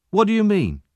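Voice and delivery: put-on puzzled boy. A narrator's reading. Charlie puzzled.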